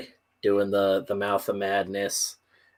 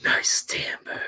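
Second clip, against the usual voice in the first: whisper. Retold or imitated. Mock whispered vocal coach line.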